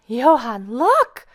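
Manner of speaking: sharp high shriek, rising on look. A narrator's reading. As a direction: shriek it, pitch leaping up. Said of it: "shrieked Missus Deland".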